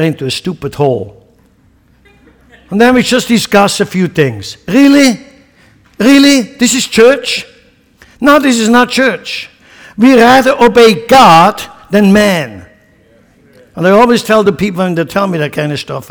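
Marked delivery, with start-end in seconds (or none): 4.7-6.4 high, loud, scornful "Really?"; incredulous outcry. Real, not acted. Mocking discussion churches. 6.4-12.7 high, loud, slow pulpit rebuke; preaching rebuke. Real, not acted. Preacher rebuking round-table church.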